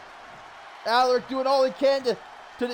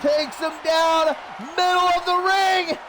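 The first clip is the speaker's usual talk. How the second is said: excited shout; 7 semitones higher, slower than usual. Real, not acted. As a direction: build to the win and hold the call. Finishing move, match won.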